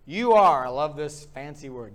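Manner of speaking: ordinary talk, nothing marked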